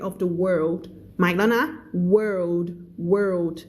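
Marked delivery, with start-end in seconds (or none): none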